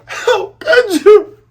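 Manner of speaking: put-on high, slow, tearful girl voice; high tearful falsetto; hurt Kaya. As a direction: plead with heartbreak. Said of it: "And Kaya's like".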